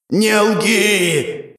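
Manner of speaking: high, drawn-out snarling cry; angry roar. A narrator's reading. Emperor lunging for the hero's throat, enraged roar. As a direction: roar it at a liar. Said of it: "прорычал император".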